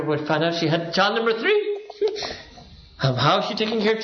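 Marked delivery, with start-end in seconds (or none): 1.6-2.4 short breathy high laugh; a quick amused laugh; airy high laugh. Real, not acted. Laughs at own joke.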